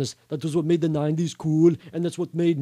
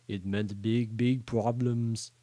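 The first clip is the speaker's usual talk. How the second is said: low, soft, drawn-out narration; ominous; 6 semitones lower, 8 dB quieter, much slower than usual. A narrator's reading. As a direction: drop low and slow on the looming trouble. Narrator stresses looming trouble in story.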